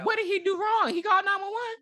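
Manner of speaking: can't believe they'd blame him; indignant. Real, not acted. Indignant defense of driver.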